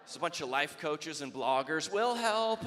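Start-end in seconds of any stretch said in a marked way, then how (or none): 1.9-2.6 put-on whiny young recruit.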